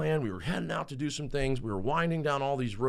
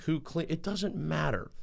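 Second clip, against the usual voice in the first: usual speaking voice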